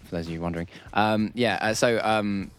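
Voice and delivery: normal talking voice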